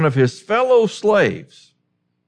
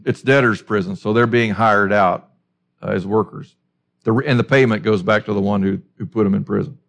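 normal talking voice

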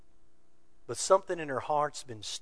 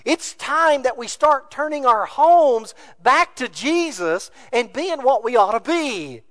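louder, rising sermon cadence; full preaching; 7 semitones higher, 7 dB louder than usual. Real, not acted. Urging the congregation's men, full force preaching.

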